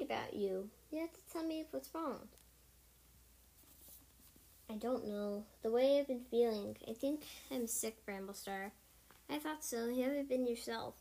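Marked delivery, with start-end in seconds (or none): none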